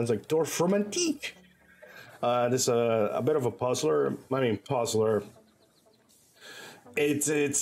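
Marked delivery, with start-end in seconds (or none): 0.3-1.3 Dorfromantik said with a playful French accent, pitched higher and rising at the end; mock French accent. Shown as a demonstration. Showing chat how he likes saying the title, playful French accent. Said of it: "French accent 'cause I'm cute like that".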